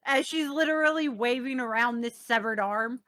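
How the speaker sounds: ordinary delivery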